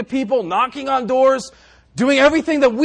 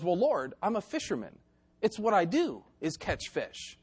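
softer, understated objection; Peter's natural reaction; 3 semitones lower, 9 dB quieter than usual. Acted. Peter answering Jesus, mild protest. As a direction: object quietly, as a matter of course. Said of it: "Peter's natural reaction was".

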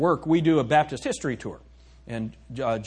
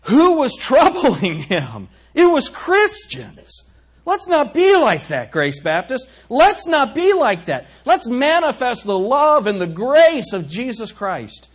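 louder, higher, slow climactic preaching; full-force preaching; 9 semitones higher, 8 dB louder, slower than usual. Acted. Pastor urging Grace Baptist not to be like that, fervent preaching. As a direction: pound it home to the congregation.

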